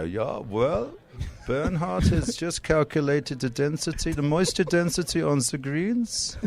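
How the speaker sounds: high, measured mock German; German accent. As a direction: play a precise German golfer, drawn out. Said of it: "you don't want me doing a German accent".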